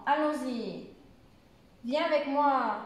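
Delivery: exclamation lingering on the ends of ALLONS-Y and MOI; exclamative intonation. Shown as a demonstration. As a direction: stretch the final "y" of "allons-y" and "moi". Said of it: "on reste un petit peu plus longtemps".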